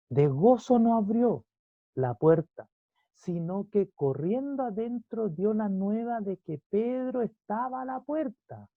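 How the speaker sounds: high, slow, projected Bible reading; drawn-out pulpit emphasis; emphatic scripture reading. Acted. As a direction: proclaim the verse, stretching the key words. Reads scripture aloud to congregation.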